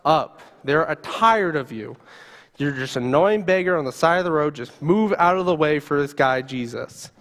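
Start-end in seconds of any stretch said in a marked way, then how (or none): none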